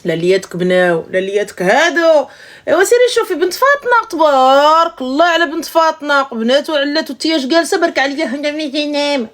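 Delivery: put-on high, bragging mother praising another girl; nagging mother in Darija. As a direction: mimic a mother comparing you to Fatna's daughter. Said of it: "Je vais le faire en français".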